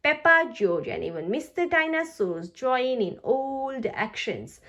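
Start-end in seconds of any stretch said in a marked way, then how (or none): none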